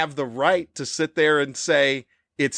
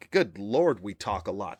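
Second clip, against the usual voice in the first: ordinary talk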